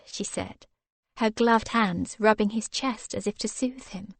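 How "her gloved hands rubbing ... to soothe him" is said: ordinary delivery, nothing marked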